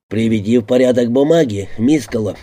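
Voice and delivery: ordinary delivery, nothing marked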